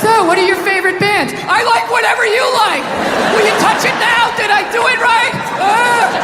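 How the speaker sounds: high-pitched, eager first-date voice; nervous teenager. Acted. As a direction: impersonate an anxious sixteen-year-old desperate to agree. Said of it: "you all nervous".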